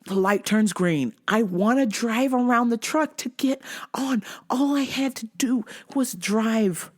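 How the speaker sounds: usual speaking voice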